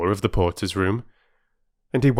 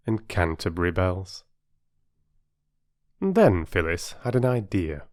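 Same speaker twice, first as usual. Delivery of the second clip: ordinary delivery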